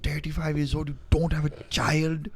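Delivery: high, mock Indian uncle berating his nephew; an Indian dad chewing out his nephew, high and exaggerated; Indian dad rant. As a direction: put on an Indian accent and scold hard. Friend's dad cussing out nephew.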